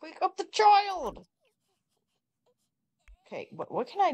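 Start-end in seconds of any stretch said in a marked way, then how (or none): 0.0-1.3 high, loud, drawn-out yell; exasperated yelling at the screen; frustrated shout. Real, not acted. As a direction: bellow the order across the house. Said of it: "repeated louder command".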